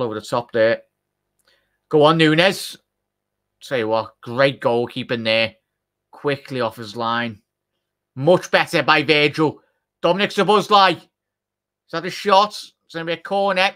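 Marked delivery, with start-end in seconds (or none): 1.9-2.8 loud, higher cheer; urging on. Real, not acted. Fan roaring Nunez on through the screen, louder and higher. 8.2-13.7 higher, louder live calling; excited play-by-play. Real, not acted. Fan narrating a Liverpool attack live, higher and louder.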